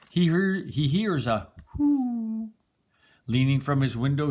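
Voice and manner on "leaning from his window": plain reading voice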